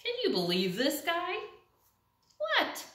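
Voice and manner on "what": innocent duckling. Acted.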